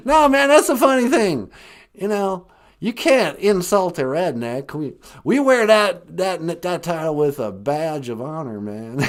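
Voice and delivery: put-on hearty good-old-boy caricature; George W. Bush. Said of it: "calling it a redneck accent?".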